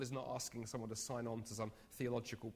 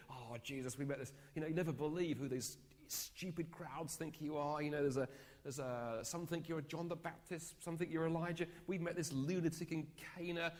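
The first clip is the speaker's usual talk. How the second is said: mock disciples joking; 5 semitones higher than usual. Disciples joking to Jesus about the crowds, mocking and amused. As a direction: ham up the disciples poking fun.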